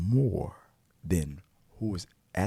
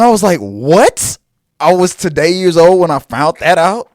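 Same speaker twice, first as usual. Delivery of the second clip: loud, high, fired-up gossip; excited reveal; 10 semitones higher, 12 dB louder than usual. Real, not acted. Fan revealing the Kiss from a Rose secret, loud and excited. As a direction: spill the plot twist with big energy.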